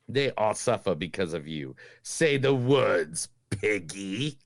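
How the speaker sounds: low, slow menacing demon. Acted. On request, host does the demon voice for his cohost. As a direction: taunt them like a demon would. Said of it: "Like the demon voice?".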